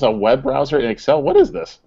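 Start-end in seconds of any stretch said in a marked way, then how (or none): 1.2-1.8 sharp high disbelieving question; can't believe what's on screen; incredulous. Real, not acted. Co-host reacting to a web browser in Excel, incredulous high question.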